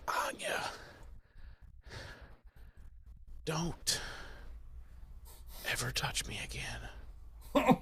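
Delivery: barely voiced, slow, dying whisper. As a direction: breathe out the last words as if dying. Dying Giles gasps last words.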